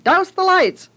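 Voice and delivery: put-on boy lookout warning his family as police arrive; frantic yell. A narrator's reading. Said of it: "they spilled out frantically".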